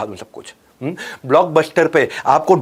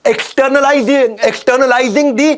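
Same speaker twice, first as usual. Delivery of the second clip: drawn-out emphasis; 8 semitones higher, 3 dB louder, much slower than usual. Shown as a demonstration. Stretching a key term.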